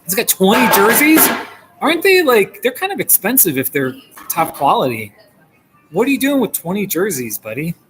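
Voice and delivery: high-pitched teasing disbelief, loud at first; mock incredulous. Streamer ribbing a viewer about twenty jerseys, mock incredulous. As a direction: pretend to be astonished, just kidding around. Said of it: "I'm just kidding".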